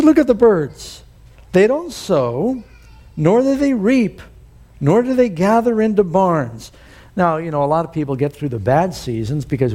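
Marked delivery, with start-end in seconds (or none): 0.0-6.7 quoting Jesus. Retold or imitated. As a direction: proclaim it like Jesus teaching the crowd. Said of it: "He said".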